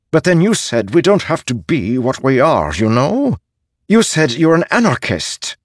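high, loud spirit voice, protesting; agitated novice spren. Acted. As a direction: throw it back at him, pitched well up. Highspren challenges Kaladin with his words.

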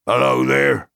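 loud, high call into the fog. A narrator's reading. Farmer hailing the rider in the fog, loud and high. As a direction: hail the unseen rider. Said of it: "called Farmer Maggot".

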